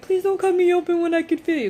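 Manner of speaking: loud, high retold panicked plea; freaking out. Patient silently begging surgeons not to cut, panicked. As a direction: plead as if inwardly panicking. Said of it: "freaking out in my head".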